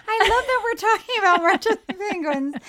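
tickled, voice pitched right up; laughing delight. Real, not acted. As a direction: say it through a burst of laughter. Laughing at the absurd topic.

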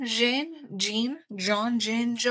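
Jean said over and over, softly, testing French and English sounds; playing with a name. Shown as a demonstration. Reading a listener's name, trying every way to say Jean. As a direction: try out Jean as Zhahn and Zheen by turns. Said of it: "instead of just thinking it's Jean, you automatically made it complicated".

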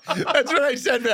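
high laughing voice. Real, not acted. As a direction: talk through the laughter. Laughing during the bit.

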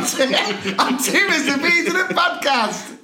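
show sign-off. Acted.

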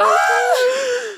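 high, shocked gasp; loud gasp. Real, not acted. Reacts to Malibu tease.

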